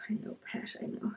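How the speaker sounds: whispered self-comfort; gently whispered reassurance; soft whisper. Real, not acted. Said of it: "I had to take a second there".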